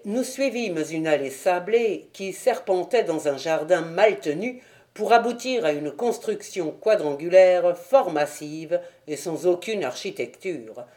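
ordinary delivery, nothing marked

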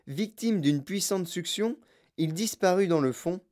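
ordinary delivery

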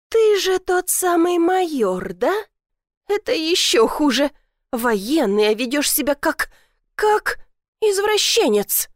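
high, halting indignation; flustered accusation. A narrator's reading. Flustered woman accuses the major. (возмущённо, запинаясь)

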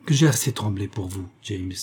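ordinary delivery

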